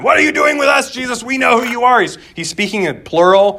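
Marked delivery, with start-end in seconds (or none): 0.0-2.0 high, loud acted demon shout; strained, high-pitched demonic yelling. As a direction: yell it out as a demon confronting Jesus. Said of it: "He's yelling out as a demon".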